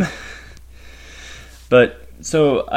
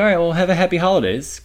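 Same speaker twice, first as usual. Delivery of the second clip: higher, louder retold send-off; cheery farewell; 8 semitones higher, 5 dB louder than usual. Store worker seeing a guest out, cheery pitched-up goodbye. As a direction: brightly wish a customer well.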